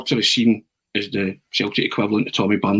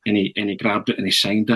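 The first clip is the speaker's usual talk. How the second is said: ordinary talk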